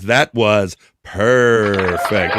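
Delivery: slow, purring stress; emphasis on purr. Retold or imitated. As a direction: draw the line out like a purr. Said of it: "with the emphasis, with the emphasis on purr".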